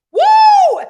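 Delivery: loud, high "woo" held out; excited whoop. Real, not acted. Preacher moved by her own message, excited whoop. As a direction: let out a piercing joyful whoop.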